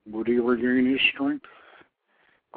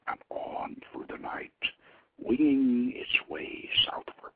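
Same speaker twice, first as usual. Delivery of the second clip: lower, hushed and slow, as if telling a secret; hushed, slow fireside narration; hushed story narration; 8 semitones lower, 6 dB quieter, slower than usual. A narrator's reading. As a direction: whisper the raven's flight into the night. Secret raven slips away.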